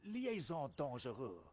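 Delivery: put-on storytelling French sergeant accent; a French soldier confessing; lightly held French accent. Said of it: "you just get a French accent".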